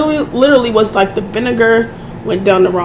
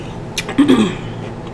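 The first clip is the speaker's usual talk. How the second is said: throat clearing; 7 dB quieter than usual. Real, not acted. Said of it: "I'm sorry I keep doing that".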